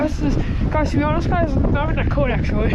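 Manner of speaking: ordinary talk, nothing marked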